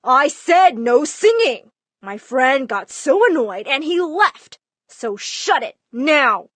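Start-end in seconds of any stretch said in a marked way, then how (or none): 0.0-1.7 slow, louder retold yell; dad screaming. Dad at the daughter's door, retold yell. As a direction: bark it through the door, slow and hard. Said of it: "Dad banged on my door screaming".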